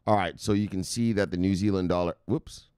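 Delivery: normal talking voice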